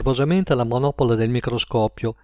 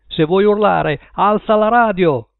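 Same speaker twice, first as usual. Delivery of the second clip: louder, higher shouted order; angry shout; 6 semitones higher, 5 dB louder than usual. A narrator's reading. Husband shouting at his wife, angry yell. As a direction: yell it at her across the room. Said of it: "gridò l'uomo".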